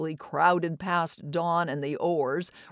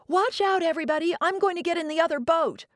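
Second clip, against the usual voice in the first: excited shout; 10 semitones higher, faster than usual. Acted. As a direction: shout over the choppy water. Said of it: "Joan stood up and shouted".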